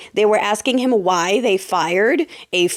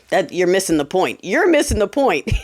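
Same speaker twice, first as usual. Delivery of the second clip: usual speaking voice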